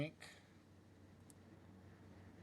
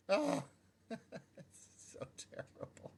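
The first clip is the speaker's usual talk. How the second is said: laughing at himself; 9 semitones higher, slower than usual. Real, not acted. Laughing at own blunder.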